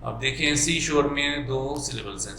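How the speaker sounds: usual speaking voice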